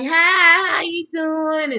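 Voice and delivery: high, loud, bright hello sung out; cheery greeting. Retold or imitated. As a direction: greet the office far too cheerfully. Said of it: "greet everybody".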